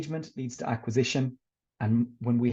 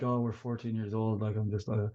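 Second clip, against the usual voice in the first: lower, softer, put-on teen voice; bored teenagers; 4 semitones lower, 5 dB quieter than usual. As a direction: sulk like a fourteen-year-old. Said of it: "they're like".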